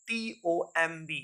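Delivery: usual speaking voice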